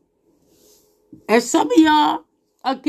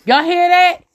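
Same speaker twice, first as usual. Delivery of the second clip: louder, higher call to the crowd; 7 semitones higher, 6 dB louder than usual. Real, not acted. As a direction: call out to make them listen. Calling out to audience.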